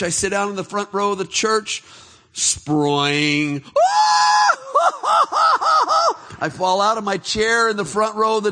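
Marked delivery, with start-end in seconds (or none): 2.3-3.6 a springy mock sproing for the fall; comic spring noise; sproing sound effect. Falling out of the chair. 3.7-6.4 a high, loud burst of laughter; shrieking laugh. Real, not acted. Laughing at his own fall.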